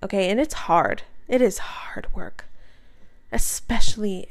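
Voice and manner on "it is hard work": hushed whisper, slow. Real, not acted. Speaker whispering to listeners about how hard love is. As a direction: whisper it like a confession.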